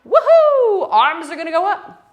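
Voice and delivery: a high, loud cheer; excited woohoo. Real, not acted. Instructor cheering the kids on, high and loud. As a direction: whoop with delight.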